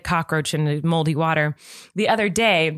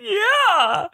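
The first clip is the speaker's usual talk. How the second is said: mock toddler wail, very high; imitated crying; 4 dB louder than usual. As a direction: wail one word like a tearful toddler. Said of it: "this is why my toddler's crying today".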